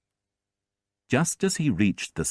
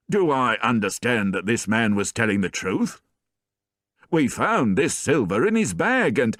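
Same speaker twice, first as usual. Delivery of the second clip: puzzled sergeant; 4 semitones higher, 3 dB louder than usual. A narrator's reading.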